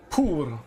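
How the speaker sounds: "Poor" with a hard-hit P and falling tone; emphatic P. Shown as a demonstration. Streamer showing how to say the word, emphatic P on "Poor". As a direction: punch the P at the start of "Poor". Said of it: "emphasis on the, on the letter P".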